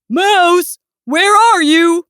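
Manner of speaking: calling out. A narrator's reading. Bear looks for missing Mouse, calling out. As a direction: call through the house for someone.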